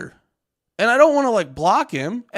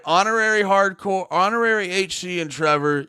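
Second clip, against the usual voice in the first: normal talking voice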